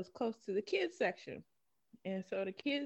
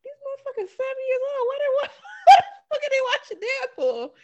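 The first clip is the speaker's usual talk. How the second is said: high, loud, laughing disbelief; shocked laughing yell; 13 dB louder than usual. Retold or imitated. Retelling her shock at the kids, laughing yell. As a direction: yell it through laughter, appalled. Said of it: "And I was like".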